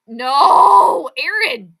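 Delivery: loud crying no; anguished protest. Real, not acted. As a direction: beg him not to say it. Pleading at co-host's comment.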